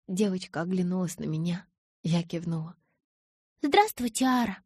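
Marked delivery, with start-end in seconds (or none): 3.6-4.6 put-on high, ringing little girl; polite child voice. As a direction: greet politely like a small girl. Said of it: "высоким звенящим голосом".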